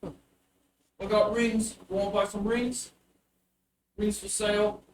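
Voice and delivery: put-on a little street kid peddling rings; bugbear child voice. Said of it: "a small little bugbear child".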